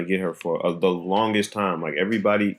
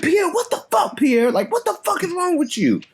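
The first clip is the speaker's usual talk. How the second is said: high-pitched exasperated yelling at Pierre; mock furious yell. Confronting Pierre in his room, a high exasperated yell. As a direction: chew him out, pitched way up.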